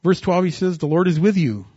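booming scripture reading; preaching at full force. Acted. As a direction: proclaim the verse to the room.

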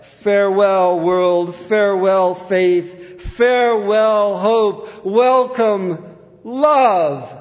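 loud, high, stately call; retold dying cry. Preacher quotes woman dying for her testimony, crying out. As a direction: cry each farewell out, building in pitch. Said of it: "cried out".

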